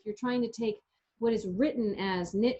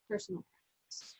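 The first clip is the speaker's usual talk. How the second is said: quiet echo; 2 semitones lower, 10 dB quieter than usual. Real, not acted. Echoing cohost softly.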